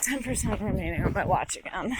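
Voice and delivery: normal talking voice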